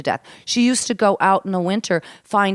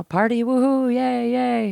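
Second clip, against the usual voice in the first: high-pitched, half-hearted cheer; mock celebrating; 6 semitones higher, slower than usual. Telling of husband home on leave, mock celebrating. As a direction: toss off a little party cheer.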